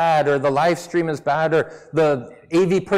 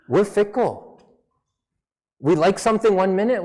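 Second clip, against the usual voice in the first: normal talking voice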